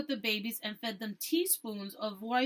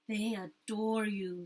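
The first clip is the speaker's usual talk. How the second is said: tender; 2 semitones lower, slower than usual. A narrator's reading. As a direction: say it gently, charmed. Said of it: "said Melina".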